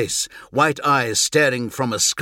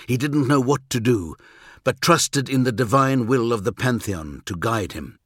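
usual speaking voice

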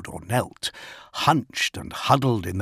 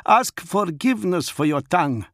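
higher, stern wolf chief; Fafnir growling a rebuke; 9 semitones higher, 3 dB louder than usual. Acted. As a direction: command the packmate to apologise.

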